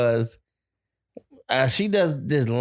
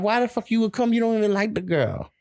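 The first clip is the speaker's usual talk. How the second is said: incredulous; 9 semitones higher, faster than usual. Retold or imitated. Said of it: "I'm like".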